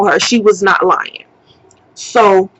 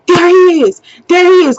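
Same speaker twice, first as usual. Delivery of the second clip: high, urgent call-out; urgent spotting shout; 7 semitones higher, 3 dB louder than usual. Retold or imitated. Friend spotting the man while driving around, urgent call-out. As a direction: call it out as if you just spotted him.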